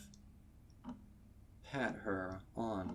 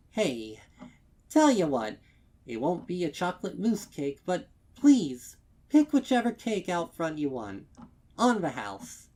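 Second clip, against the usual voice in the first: high, loud, fast overeager pitch; forced eager offer; 9 semitones higher, 14 dB louder, faster than usual. Acted. Mare insisting the stallion take any cake for free. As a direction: rush the offer with brittle cheer.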